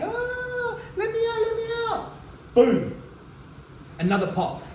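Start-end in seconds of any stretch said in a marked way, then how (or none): 0.0-2.1 high, thin, mock whimpering plea; mock crying puppy; imitated dog whine. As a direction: whine like a crated puppy begging. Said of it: "I'm gonna start crying".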